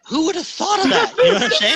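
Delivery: high, loud question through real laughter; laughing incredulously. Real, not acted. Host cracking up over ram wool story, laughing incredulously. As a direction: crack up as you ask, pitch shooting up.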